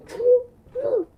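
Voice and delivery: loud high shout. Shown as a demonstration. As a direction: belt out true love. Repeats the phrase shouted.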